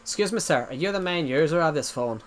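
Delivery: imitating an Irish scam caller; Irish-accented cold caller. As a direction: put on an Irish accent for a phone enquiry. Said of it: "I can do the Irish accent pretty well".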